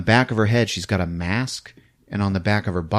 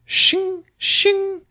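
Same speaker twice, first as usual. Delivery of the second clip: high, ringing metallic shings; two sharp high blade noises; imitated blade sound. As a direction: slice the air like hedge clippers. Imitates hedge clippers.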